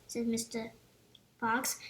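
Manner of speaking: ordinary delivery, nothing marked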